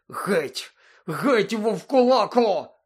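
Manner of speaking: high, loud, slow yell through pain. A narrator's reading. Ron to Lupin, in pain, yelling high and loud. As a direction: drive him off while hurting. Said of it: "застогнав від болю".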